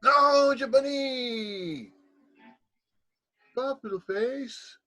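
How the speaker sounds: high, drawn-out cutesy greeting; playful bunny voice. Pretend. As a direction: coo it very high and slow, stretching the vowels. Playful pet-name greeting to Rabbit.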